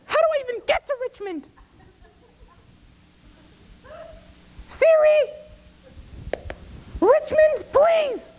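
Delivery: very high shrieking mother voice; hysterical mom. Retold or imitated. Frantic mother on the phone to her drunk son, very high.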